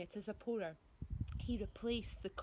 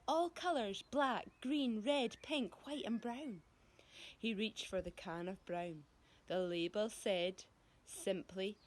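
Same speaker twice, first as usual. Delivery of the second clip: ordinary delivery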